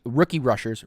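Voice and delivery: ordinary talk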